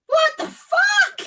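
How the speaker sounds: "What the fuck" blurted high and loud, slow; a stunned fairy, appalled; fairy watches mum bake bread, shocked outburst. Retold or imitated. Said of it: "And the b-, fairy goes".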